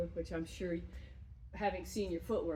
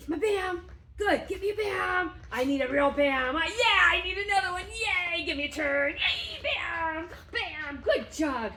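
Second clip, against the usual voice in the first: high, loud, gushing praise for the dog; high-pitched party voice; excited praise; 9 semitones higher, 7 dB louder than usual. Shown as a demonstration. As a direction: hype the dog up with big squeaky cheers. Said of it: "make him aware that this is fun".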